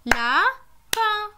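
Lapin said slowly as La-pin; syllables split. Shown as a demonstration. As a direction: pull Lapin apart into its two syllables. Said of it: "Combien de syllabes entends-tu dans lapin?". (Lapin, syllabe par syllabe)

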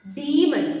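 ഡീമൺ said "dee-mun", stretching the ee; long ee demon. Shown as a demonstration. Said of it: "ശരിക്കുള്ള pronunciation വന്നിട്ട് ഡീമൺ".

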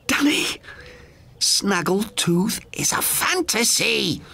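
put-on high firm old sister's voice, slow; insist, slowly, it isn't real; high, firm sisterly insistence; asserted. A narrator's reading. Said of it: "she asserted".

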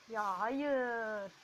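loud, pleading groans of no; real furious dismay; angry despair. Real, not acted. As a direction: drag out the final no in real anger. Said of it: "Arkadaşlar çok sinirlendim ya".

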